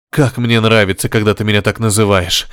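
usual speaking voice

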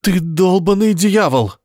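high, slow frightened cry; terrified shout. Acted. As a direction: scream it in terror. Terrified painter shouts at devil. (испуганный крик)

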